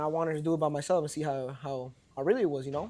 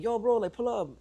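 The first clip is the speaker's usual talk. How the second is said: higher-pitched, casual beckoning; imitated calling over; 7 semitones higher than usual. Promoter spots him in the line, imitated friendly call. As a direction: mimic a promoter waving a buddy in.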